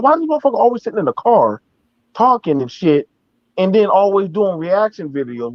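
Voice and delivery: ordinary delivery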